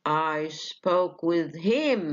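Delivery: all the weight on a long "him"; contrastive stress on HIM. Shown as a demonstration. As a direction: correct them, stretching and accenting "him". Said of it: "لأن him هنا is contrastive".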